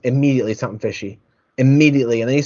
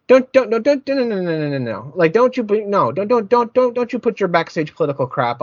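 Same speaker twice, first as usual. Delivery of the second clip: exasperated pleading; 8 semitones higher than usual. Real, not acted. Ranting at TV commentary.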